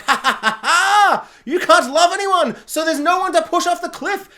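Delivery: hammed-up triumphant jeer; mocking taunt. Gamora taunting her dad Thanos, hammed-up mocking triumph.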